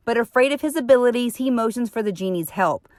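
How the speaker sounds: usual speaking voice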